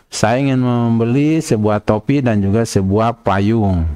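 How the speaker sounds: usual speaking voice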